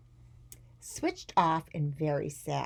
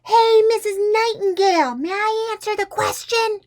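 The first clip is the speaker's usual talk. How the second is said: put-on high, full-volume child's holler; head-splittingly loud; 12 dB louder than usual. A narrator's reading. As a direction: shout across the classroom to the teacher. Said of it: "head-splittingly loud".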